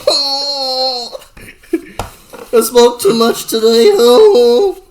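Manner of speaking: high, loud goofy stoner drawl; Shaggy stoner voice. Retold or imitated. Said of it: "Scooby-Doo and Shaggy".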